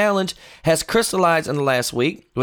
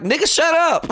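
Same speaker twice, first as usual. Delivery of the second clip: high exasperated "shut up"; mock establishment voice; 8 semitones higher than usual. Establishment snapping at Trump to stop, high exasperated. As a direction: voice the fed-up party, pitched high. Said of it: "Republican establishment is like".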